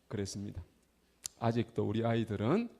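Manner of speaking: ordinary delivery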